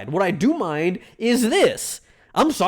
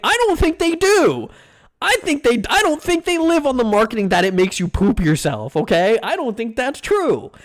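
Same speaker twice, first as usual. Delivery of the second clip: heated high pitched objection; indignant; 6 semitones higher than usual. Real, not acted. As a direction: push back hard on the claim. Arguing with chat about Taco Bell.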